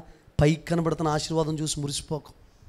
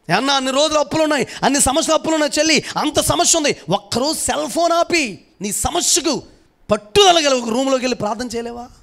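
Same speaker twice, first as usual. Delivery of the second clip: higher, fast and louder appeal to the sister; fiery preaching; 8 semitones higher, 4 dB louder than usual. Acted. Pastor urging his listeners to pray, fiery preaching. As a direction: press the congregation hard, rapid-fire.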